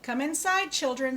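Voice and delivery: calling Daddy Pig. Acted. Daddy Pig calls his children inside, a loud call. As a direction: call the kids in from the rain. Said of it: "says Daddy Pig".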